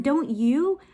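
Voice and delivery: usual speaking voice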